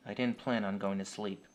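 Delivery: ordinary delivery, nothing marked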